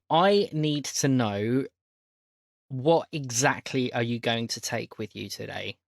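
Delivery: normal talking voice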